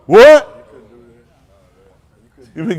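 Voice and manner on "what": loud high what; mock rude kid. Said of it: "You had to answer".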